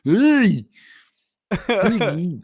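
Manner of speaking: high puppet frog voice; Kermit voice. Pretend. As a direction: call out as Kermit. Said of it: "I can't even do 'em".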